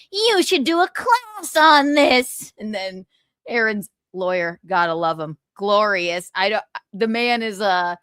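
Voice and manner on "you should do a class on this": mocking his advice. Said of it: "you were like".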